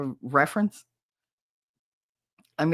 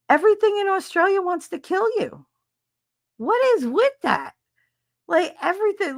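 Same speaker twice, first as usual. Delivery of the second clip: much higher, louder incredulous rant; exasperated; 13 semitones higher, 3 dB louder than usual. Real, not acted. Streamer rants to Australian viewer, high and incredulous. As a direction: squeal at how dangerous it all is.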